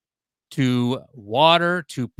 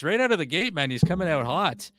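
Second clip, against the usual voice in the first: ordinary talk, nothing marked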